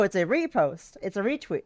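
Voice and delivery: ordinary talk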